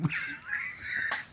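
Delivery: soft, high wheeze of laughter; real breathless giggle; wheezing laugh. Real, not acted. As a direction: laugh till the voice drops out. Laughs after mimed promise.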